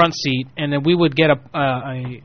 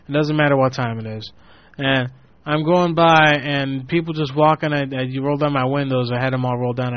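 normal talking voice

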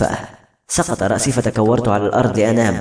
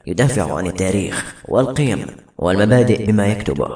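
low, measured voice of counsel; solemn mentor figure; 4 semitones lower than usual. Acted. Urging a writer to fight on with his pen, solemn and low. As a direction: lower the voice to exhort a writer.

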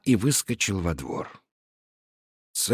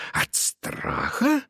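incredulous echo; 14 semitones higher, 3 dB quieter, much slower than usual. Acted. Echoes brother's words.

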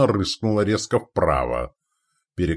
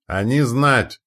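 higher, raised cry; short carrying shout; 5 semitones higher, 4 dB louder, slower than usual. A narrator's reading. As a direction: call it out urgently to shipmates. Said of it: "крикнул Треска".

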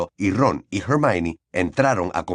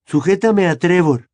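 higher, louder show-off tone; lightly voiced proud schoolboy; proud boy voice; 8 semitones higher, 6 dB louder than usual. A narrator's reading. Neville shows off plant.

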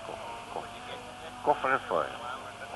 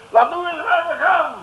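much higher, loud and slow; gleeful boast; 13 semitones higher, 10 dB louder, slower than usual. Acted. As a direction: crow it triumphantly. (triomfantelijk opschepperig)

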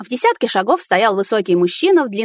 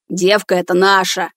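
hoarse bark. Acted. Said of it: "рявкнул хриплым голосом".

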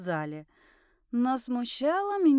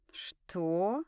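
a bit dazed; 5 semitones lower, 5 dB quieter than usual. A narrator's reading. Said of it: "немного ошалело отозвался Валька".

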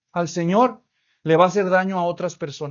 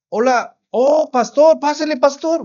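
higher, slow, eager host greeting the pastor; overly friendly welcome; 6 semitones higher, 4 dB louder than usual. Retold or imitated. Husband welcoming the surprise pastor, higher and overly friendly. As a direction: play the suddenly sweet husband. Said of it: "muy amable".

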